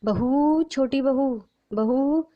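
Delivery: high, drawn-out summons; calling out. A narrator's reading. Mother-in-law at daughter-in-law's door, calling out. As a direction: call through a closed door. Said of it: "दरवाज़ा खटखटाकर आवाज़ लगाती है".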